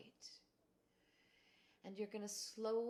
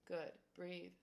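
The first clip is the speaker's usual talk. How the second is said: ordinary talk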